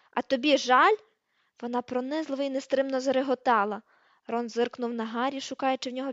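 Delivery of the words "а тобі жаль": loud, scornful rising question; scornful retort. A narrator's reading. Hermione mocking Ron's apology, loud and scornful. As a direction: throw his apology back at him.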